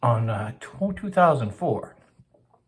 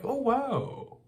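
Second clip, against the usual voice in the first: high, softly drawn-out wonder; mock-impressed, pitched way up; acted amazement; 12 semitones higher, 4 dB quieter than usual. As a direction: mimic being wowed by a novelty. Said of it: "novel, you know, so he's like".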